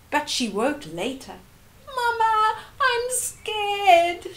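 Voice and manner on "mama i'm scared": put-on high, tearful little girl's voice; a frightened child calling for mum in the night; scared crying child. A narrator's reading. Sanele woken at night, calling her mama, high and tearful. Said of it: "she cried".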